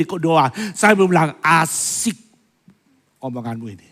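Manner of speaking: ordinary delivery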